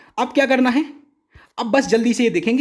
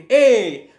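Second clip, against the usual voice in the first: a loud ए driven from the throat; forceful call; 6 dB louder than usual. Shown as a demonstration. Teacher shows the strong throat ए that overpowers the listener. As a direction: shout it to make them back down. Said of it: "ए बोला खूब जोर देके".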